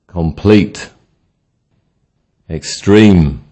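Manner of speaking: weak start, strong end: comPLETE, exTREME, aLIVE, eNOUGH; word list, each adjective punched on its second syllable; second-syllable stress. Shown as a demonstration. Teacher drilling adjectives with stress on the second syllable. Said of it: "Adjectives with stress on the second syllable".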